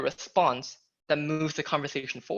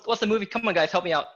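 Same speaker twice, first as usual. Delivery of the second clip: usual speaking voice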